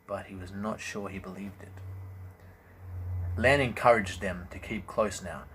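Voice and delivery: plain reading voice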